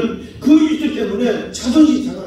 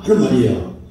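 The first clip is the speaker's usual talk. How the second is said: ordinary talk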